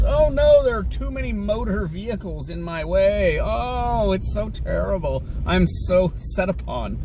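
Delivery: hammed-up high whining complaint; mock whining. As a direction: whine at his own first world problems. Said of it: "Look at my first world problems".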